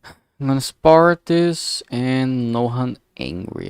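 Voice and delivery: ordinary talk, nothing marked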